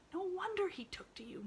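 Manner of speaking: whispered, high; hushed marvel; awed whisper. Acted. Maid whispers to herself in awe.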